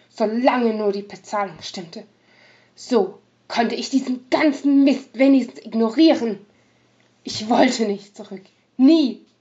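loud, threatening rogue; menacing undertone. Acted. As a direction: threaten her with buried anger. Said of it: "mit bedrohlich wirkendem Unterton".

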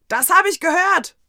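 laut und hoch gebrüllt; wütend zurückbrüllen. A narrator's reading. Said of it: "brüllte ich noch". (gebrüllt)

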